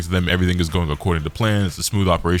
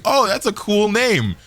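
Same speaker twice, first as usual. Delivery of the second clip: naive Goku; 12 semitones higher, slower than usual. Retold or imitated. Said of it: "Goku's like".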